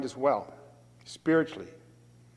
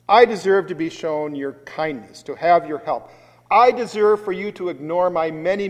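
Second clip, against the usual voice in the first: louder, insisting on every I deserve; proud entitled demand; 4 semitones higher, 11 dB louder than usual. Shown as a demonstration. Preacher voicing a proud sinner demanding from Jesus, entitled and loud. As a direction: demand it as if you are owed. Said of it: "consider the very opposite".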